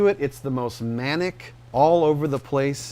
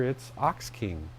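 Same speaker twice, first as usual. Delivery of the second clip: usual speaking voice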